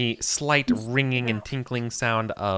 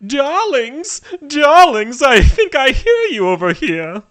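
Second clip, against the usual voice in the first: high, loud society grande dame; Lady Cosmonauta; 10 dB louder, slower than usual. Acted. Vampire lady calling to her darlings, theatrical high voice. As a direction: call out grandly in a high, carrying voice. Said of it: "It's Lady Cosmonauta".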